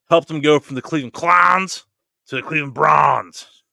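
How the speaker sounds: put-on Yinzer accent. Host introducing guest, light Yinzer accent. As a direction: lean lightly into a Yinzer accent on Cleveland Browns. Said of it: "a Yinzer accent".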